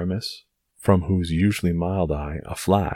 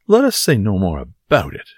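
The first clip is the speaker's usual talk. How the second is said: louder, exaggerated mocking quote; scornful echo; 4 semitones higher, 7 dB louder than usual. Porthos quotes Aramis back at him, scornfully. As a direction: throw the words back in disgust.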